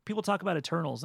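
ordinary talk

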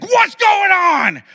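very high, loud, drawn-out holler; imitated yell. Buddy imagines his household after something breaks, yelling. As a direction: mimic an alarmed parent yelling through the house. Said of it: "And, like, yell".

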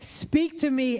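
heartfelt cry. Acted. Believer crying out to God in prayer, pleading. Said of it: "That's the cry of my heart".

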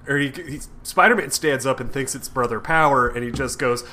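usual speaking voice

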